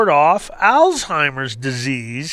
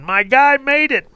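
much higher, excited cheer; fan screaming; 8 semitones higher than usual. Retold or imitated. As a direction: shout it at the TV in triumph. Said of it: "everybody's screaming".

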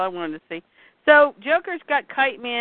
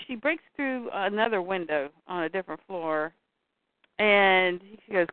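normal talking voice

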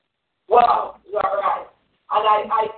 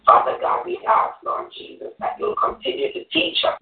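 fervent, earnest congregational prayer; closing prayer; 3 semitones higher than usual. Real, not acted. Woman closing the Bible study in prayer, earnest prayer. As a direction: pray it aloud, calling on God phrase by phrase.

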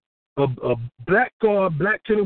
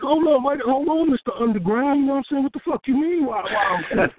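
indignant self; 7 semitones higher than usual. Retold or imitated. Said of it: "I'm like".

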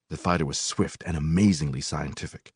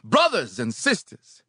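shouting preacher; 10 semitones higher than usual. A narrator's reading. Preacher addressing brothers and sisters, shouting. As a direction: announce the text like a preacher. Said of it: "the preacher shouted".

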